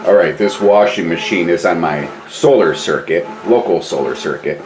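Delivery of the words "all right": normal talking voice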